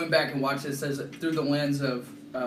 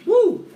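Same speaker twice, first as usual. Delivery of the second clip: loud high-pitched woo; high whoop; 11 dB louder than usual. Real, not acted. Reacting to spicy heat.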